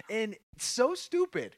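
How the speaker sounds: usual speaking voice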